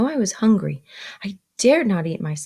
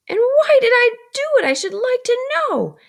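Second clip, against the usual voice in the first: very high, hissed exclamation; exclaiming sister; 6 dB louder than usual. Acted. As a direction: hiss it out high and outraged. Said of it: "exclaimed my sister".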